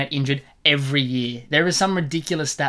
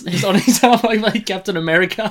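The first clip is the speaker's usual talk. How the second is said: laughing; 8 semitones higher, 7 dB louder than usual. Real, not acted. Laughing through a story.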